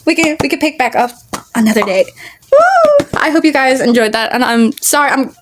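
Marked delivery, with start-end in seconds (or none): 2.5-3.1 high loud whoop of relief; excited woo. Real, not acted. Reader whoops at finally wrapping up, loud high cheer.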